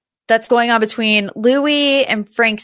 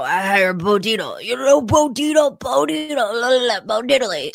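sing-song mimicry dissolving into la-la nonsense; mocking babble; 3 semitones higher than usual. Podcaster mimicking Louis harping on Bo Dietl, mocking babble. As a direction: babble the name mockingly, pitch jumping up midway.

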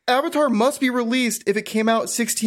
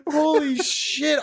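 drawn-out, astonished curse; stunned disbelief; 5 semitones higher, much slower than usual. Real, not acted. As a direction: let it out slowly, amazed. Stunned reaction to chatbot's reply.

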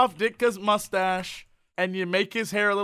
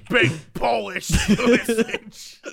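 slow mock Chicago accent, laughing; hammed-up Chicago vendor voice; 5 dB louder, much slower than usual. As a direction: drag it out like a Chicago deli guy. Mocking Chicago voice.

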